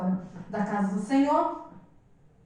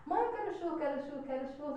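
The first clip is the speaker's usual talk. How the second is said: put-on a soft, whiny little-girl plea; mock child whimper asking mom for churros; imitating crying child; 3 semitones higher, 12 dB quieter, faster than usual. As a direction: beg softly like a toddler who wants churros. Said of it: "a menina começou a chorar".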